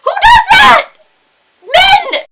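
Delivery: very high, full-volume outburst; outraged shouting. Real, not acted. Woman exploding over how men stare, outraged shout. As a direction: yell it out in utter exasperation.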